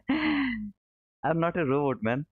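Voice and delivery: slow, laughing denial. Real, not acted. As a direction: laugh it off slowly. Laughing denial of being a robot.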